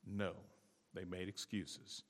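ordinary delivery

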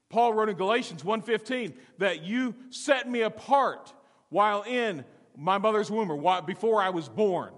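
high, loud, driving sermon; full-force preaching. Real, not acted. Pastor preaching to his church, high and loud. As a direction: preach it with fire.

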